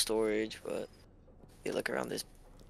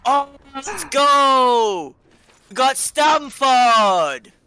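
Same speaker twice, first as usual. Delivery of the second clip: high, loud, drawn-out cheer; 12 semitones higher, 13 dB louder, much slower than usual. Real, not acted. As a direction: celebrate loudly. Celebrating finding name tag.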